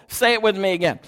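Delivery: ordinary talk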